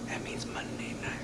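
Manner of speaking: breathy conspiratorial whisper; hushed whisper. Acted. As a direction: let him in on a secret, whispered. Fan hushed about his show.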